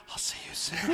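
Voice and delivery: whisper. Pretend. Mimicking stroking the screen.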